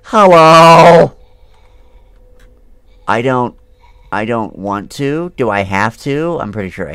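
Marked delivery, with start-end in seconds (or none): 0.0-1.2 loud, high terrified hello; scared hi; nervous greeting. Real, not acted. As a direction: greet the monster in fear. Greeting a scary creature.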